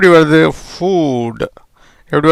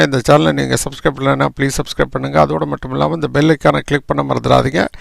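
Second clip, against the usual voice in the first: ordinary talk, nothing marked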